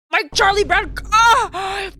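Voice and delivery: mock anguished shout; crying with rage. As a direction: wail over the lost curl. Mock anguish at lost curl.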